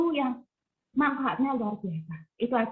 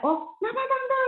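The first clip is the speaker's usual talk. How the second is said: high, startled "oh, what is it"; surprised greeting. Retold or imitated. Surprised to find the old neighbour knocking at her door. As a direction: sound caught off guard at the door.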